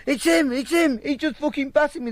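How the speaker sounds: mock accusation. Victim accusing him to police.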